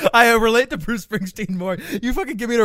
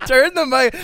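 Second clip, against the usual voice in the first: ordinary talk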